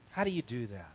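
hushed echo of the question; quiet reflective repeat. Shown as a demonstration. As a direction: let it sink in, pondering.

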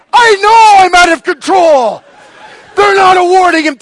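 high, loud re-enacted rant; furious shout. Husband answering wife about the referees, furious shouting. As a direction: yell it back as an enraged fan. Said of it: "absolutely furious".

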